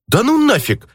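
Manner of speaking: high grumbling outburst; grumbling exclamation. A narrator's reading. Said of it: "проворчал мой друг".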